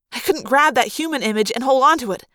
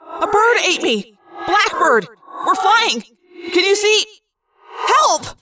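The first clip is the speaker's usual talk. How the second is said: panicked shouting; 8 semitones higher, slower than usual. Acted. Swallowed, screaming for help.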